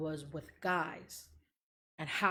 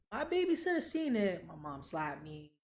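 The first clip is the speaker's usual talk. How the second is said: ordinary talk, nothing marked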